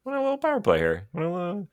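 very high, fast squeaky voice, teasing the refs for gifting a power play; a cartoonish high-pitched ref taunting the Penguins; mock referee voice. Mocking the referee's fake generosity.

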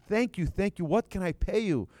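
high, loud retold thanks; effusive gratitude. Rabbi thanking the crying man for teaching him, effusively grateful. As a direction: pour out thanks, pitched well up.

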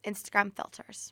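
breathy whispered aside. Acted. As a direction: drop to a whisper. Playful list, whispered.

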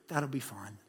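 sigh, then a low, hushed acceptance; feigned reluctance. Acted. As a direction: play it down with a soft, put-on sigh. Feigns reluctant acceptance of dream offer.

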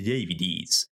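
ordinary talk, nothing marked

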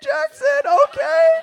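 cartoon-high self-praise; impressed inner voice. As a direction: squeal it, amazed at yourself. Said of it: "inside I was like".

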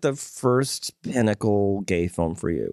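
ordinary talk

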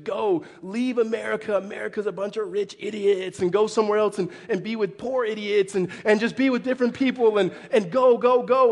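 loud, hammed-up rally cry for going overseas; preacher mocking a church missions push, building to a mock shout; mock missionary pep talk. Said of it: "this big missionary push".

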